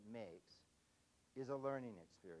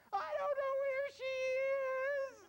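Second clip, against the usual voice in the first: hammed-up bawling little kid, drawn out; high, loud mock tearful whine; crying child; 9 dB louder, slower than usual. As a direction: wail the answer slowly, very high and loud. Acted crying child answers agent.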